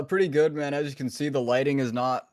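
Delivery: ordinary talk, nothing marked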